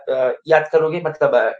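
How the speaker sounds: usual speaking voice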